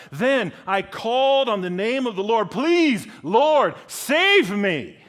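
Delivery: loud declamation building to a high cry; dramatic psalm reading. Acted. Preacher reads psalm calling on the Lord, dramatic. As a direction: build from dread to a pleading cry.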